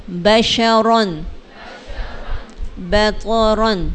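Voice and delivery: slow بطرن with ط made heavy; heavy toa. Shown as a demonstration. Teacher demonstrates heavy ط in بطرن slowly. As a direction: thicken the ط in بطرن. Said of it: "تو کو موٹا کریں گے".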